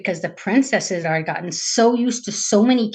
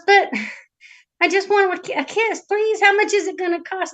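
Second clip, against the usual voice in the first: lightly voiced princess, pitched up; pleading princess; 9 semitones higher than usual. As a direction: plead a little, higher than usual.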